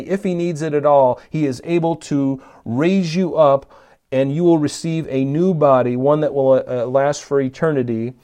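ordinary talk, nothing marked

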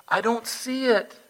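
high, emphatic mock reply; God insisting, acted.

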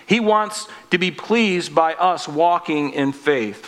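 normal talking voice

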